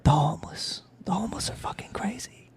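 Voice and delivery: imitated conspiratorial whisper; hushed warning. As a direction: whisper it like a scandalous secret. Retelling locals' hushed warning.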